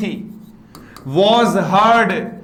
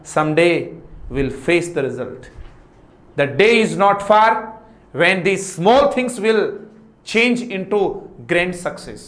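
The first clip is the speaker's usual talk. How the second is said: slow motivational speech; 3 semitones lower, much slower than usual. Acted.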